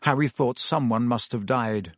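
usual speaking voice

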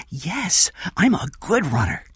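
put-on high-pitched child's assurance; eager child. Jeff ready to run, eager child.

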